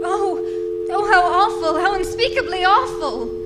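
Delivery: loud, rising horror, slowing on the last words; horrified. Acted. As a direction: gasp it out in shocked dismay. Overhears murder plot, panics.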